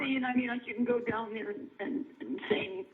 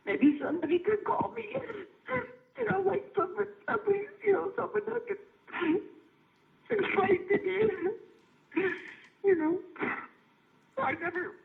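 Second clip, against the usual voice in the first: shaking; 9 semitones higher than usual. Real, not acted. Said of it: "I'm just, I'm shaking".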